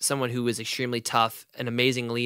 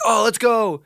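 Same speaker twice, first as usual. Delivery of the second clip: eager guy; 10 semitones higher, 6 dB louder, slower than usual. Retold or imitated. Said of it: "your boy's like".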